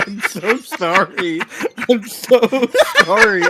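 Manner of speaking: giggling through the words; laughing apology. Real, not acted. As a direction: apologize while cracking up. Apologizing to chat while laughing.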